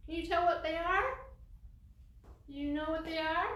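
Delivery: ordinary talk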